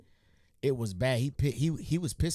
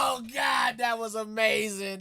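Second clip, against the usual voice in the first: excited shout; 7 semitones higher, 5 dB louder than usual. Real, not acted. Reacting to comedian's callback joke.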